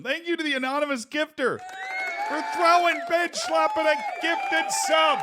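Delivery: loud thank-you shout. Real, not acted. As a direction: boom out thanks to the gifter.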